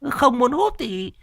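high-pitched boastful woman's voice; a puffed-up wife crowing over her luck; smug wife. A narrator's reading. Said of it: "Mộ Cúc phỏng mũi". (giọng mụ vợ vênh váo the thé)

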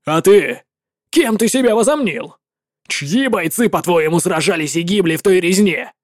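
indignant commander shouting down a rival; a general who refuses to share the glory; furious legate. Acted. Legate rages at the warlock.

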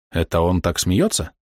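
ordinary delivery

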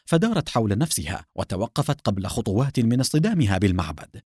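ordinary delivery

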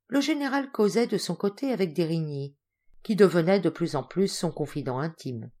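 ordinary delivery, nothing marked

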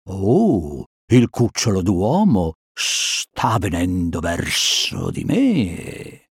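slow, soft serpent voice; slow hissing snake murmur; sly snake. Acted. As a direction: stretch it out like a snake eyeing prey. Snake Kaa voiced, watching his prey.

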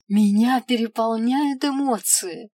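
loud, high overjoyed exclamation; christmas elf. Acted. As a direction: burst out with emotion. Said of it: "воскликнул эльф".

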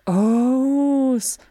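long, high, loud "oh"; chorus of ohs. A narrator's reading. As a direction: draw out a high awed "ohhh" like children. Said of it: "a chorus of".

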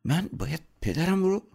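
halting, frightened question climbing high; scared, hesitant disbelief; trembling voice. A narrator's reading. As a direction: stammer it out fearfully, with long pauses. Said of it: "با صدای لرزون گفت".

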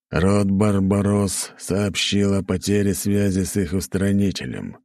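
slow, solemn royal announcement; demon king voice. Acted. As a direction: speak like a grave demon king.

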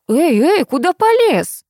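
high, drawn-out outraged challenge; indignant. A narrator's reading. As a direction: pull him up sharply with rising pitch. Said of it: "возмутилась мать". (возмущённо, на повышенных тонах)

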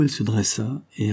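ordinary delivery

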